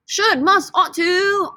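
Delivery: high-pitched put-on British accent. Shown as a demonstration. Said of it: "say it with a British accent".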